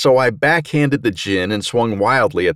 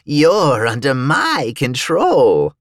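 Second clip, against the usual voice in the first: slow djinn voice, raised and drawn out; controlling djinn; 5 semitones higher, much slower than usual. A narrator's reading. Djinn to the man he controls, slow and commanding. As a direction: draw it out like a hypnotic command. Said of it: "I heard the djinn say".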